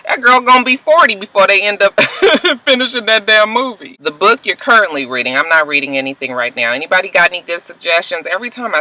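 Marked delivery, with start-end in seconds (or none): 0.1-4.0 higher, breaking into a laugh; laughing rant. Real, not acted. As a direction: joke in exasperation and crack up midway.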